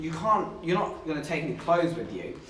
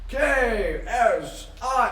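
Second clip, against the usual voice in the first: very slow, higher ring announcer call; announcing a fighter; 6 semitones higher, much slower than usual. Retold or imitated. Streamer announces [name]'s entrance to chat, slow and higher. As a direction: draw out each letter like a ring entrance.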